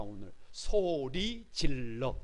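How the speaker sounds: drawn-out, very slow chant; slow call-out. Shown as a demonstration. Pastor modelling the shout for the congregation, very slow. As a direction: stretch each word to lead the crowd. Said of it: "다 같이 한번 외쳐보십시다".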